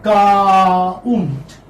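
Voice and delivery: "Count" stretched loud, gliding from a long "kaa" into "unt"; teacher slowly sounding out the diphthong in "Count"; diphthong demonstration. Shown as a demonstration. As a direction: draw out the vowel glide in "Count". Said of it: "I'm repeating this diphthong".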